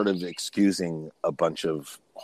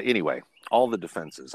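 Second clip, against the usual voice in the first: ordinary delivery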